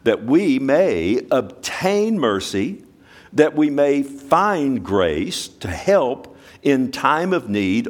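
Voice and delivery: measured, emphatic reading of the verse; slow scripture reading. Acted. Preacher reading Hebrews aloud to the congregation, slow and weighty. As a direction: let each word land slowly.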